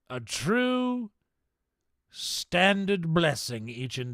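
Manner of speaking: theatrical mock-aristocratic accent; hammed-up posh accent. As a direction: gush over the viewers in a mock posh accent. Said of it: "in this accent".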